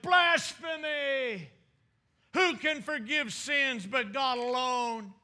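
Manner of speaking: high outcry, first word drawn out; shouted accusation. Retold or imitated. Preacher as the teachers of the law accusing Jesus, shouted accusation. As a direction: cry out in outrage, as a preacher acting it. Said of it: "And they shouted".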